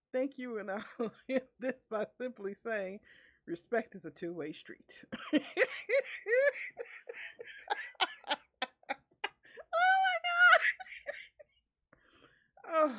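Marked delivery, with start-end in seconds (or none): 5.3-12.9 big laughing outburst. Real, not acted. Cracking up at the story.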